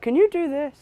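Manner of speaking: high-pitched mock request; imitated interviewer. Interviewers asking the actor to perform, high imitated voice. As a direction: lift it into an interviewer's pleading question.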